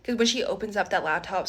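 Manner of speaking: ordinary talk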